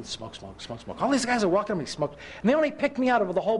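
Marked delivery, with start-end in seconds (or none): 0.0-1.0 much softer, lower, baffled echo; puzzled hush. Retold or imitated. Preacher echoing street dealers, puzzled and far softer. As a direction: drop the voice, mystified.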